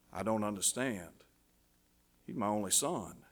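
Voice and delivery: low, hushed protest; stunned, grieving father. Acted. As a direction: say it softly, as a father in shock. Father told his son must die.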